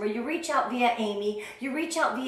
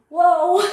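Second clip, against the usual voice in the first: surprised whoa; 7 semitones higher, 11 dB louder than usual. Real, not acted. After sharing about ex-husband.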